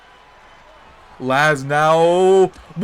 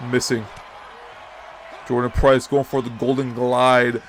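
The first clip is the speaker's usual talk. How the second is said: ordinary talk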